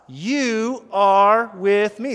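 loud, slow and held; strong preacher's refrain for all to echo; declaration to God. Shown as a demonstration. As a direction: proclaim each word with force. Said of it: "but I can say to you".

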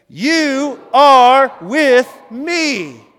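slow, high, booming shout; drawn-out, full-force declaration of faith; full conviction. Shown as a demonstration. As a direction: proclaim each word at the top of your voice. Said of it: "say it with full conviction".

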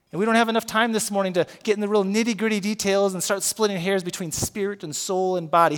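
ordinary delivery

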